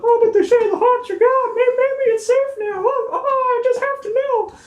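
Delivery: very high, breathless townsperson voice; nervous townsfolk. Retold or imitated. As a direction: squeak it like anxious gossip. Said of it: "but they're like".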